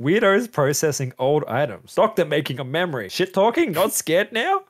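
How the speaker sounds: high, loud headline-style note reading. Acted. Reads own notes aloud.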